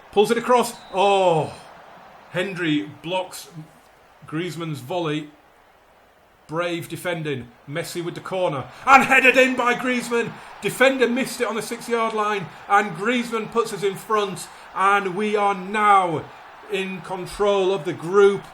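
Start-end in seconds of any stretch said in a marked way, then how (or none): 0.1-1.5 higher, louder rising alarm; real near-miss exclamation; excited play-by-play gasp. Real, not acted. Near-miss in live match. 8.9-18.4 real elated goal call; goal celebration shout. Real, not acted. Winning goal celebrated live.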